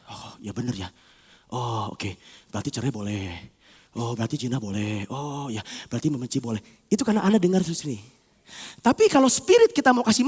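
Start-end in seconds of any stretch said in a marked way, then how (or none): 0.1-6.6 very low, hushed musing; gullible agreement. Acted. Soul swallowing the world's arguments, hushed and credulous. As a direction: softly draw conclusions, half to oneself.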